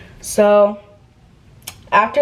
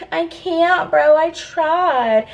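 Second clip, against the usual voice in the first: high, drawn-out excuse; slow, high-pitched mock protest; helpless protest; 8 semitones higher, much slower than usual. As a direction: imitate a friend pleading helplessness. Friend protests she tried.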